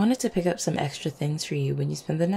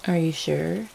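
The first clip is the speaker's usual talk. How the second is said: ordinary delivery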